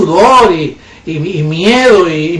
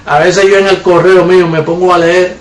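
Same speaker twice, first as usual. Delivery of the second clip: ordinary talk, nothing marked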